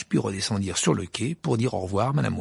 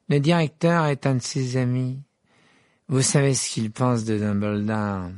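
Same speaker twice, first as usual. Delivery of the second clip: slow, drawling arrogant schoolboy; drawling voice heard through the open door; Malfoy's drawl. A narrator's reading. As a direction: stretch the words out with lazy contempt. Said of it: "une voix traînante et familière".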